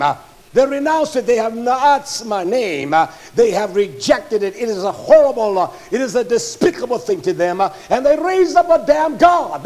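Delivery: loud, high, fired-up sermon voice; thundering pulpit delivery; full-force preaching. Acted. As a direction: preach it hard to the congregation.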